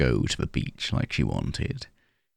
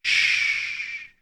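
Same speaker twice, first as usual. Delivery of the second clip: hushed, airy shh; breathy shush. A narrator's reading. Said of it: "only hears a breathy".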